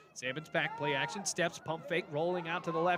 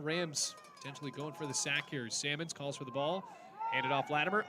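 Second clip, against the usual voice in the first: ordinary talk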